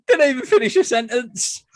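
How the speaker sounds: high, loud laughing disbelief; cracking up. Real, not acted. Friend cracking up at the interrupted quote, high laughing outburst. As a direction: squeal it through real laughter.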